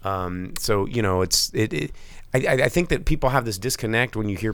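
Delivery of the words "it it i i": usual speaking voice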